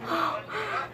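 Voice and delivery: soft unvoiced gasp; breathy little gasp before going on; audible breath. Real, not acted. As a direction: take a quiet, airy breath in. Breath before the worst question.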